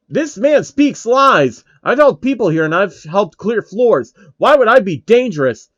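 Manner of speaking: mock-grand speech; dramatic orator. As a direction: ham up the innocent victim.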